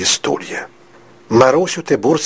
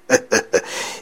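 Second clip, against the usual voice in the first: short, breathy villain's chuckle; menacing chuckle; 4 dB quieter than usual. Acted. Tyburtsy threatening the boy, menacing chuckle.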